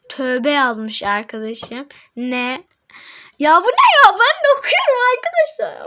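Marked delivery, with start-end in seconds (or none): 3.4-5.9 excited exclaim. Real, not acted. Reacting excitedly to chat.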